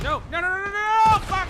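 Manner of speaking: rising, frantic high yells; panicked yelling. Real, not acted. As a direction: panic as the enemy closes in. Getting killed in game.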